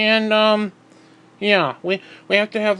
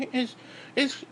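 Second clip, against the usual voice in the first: ordinary talk, nothing marked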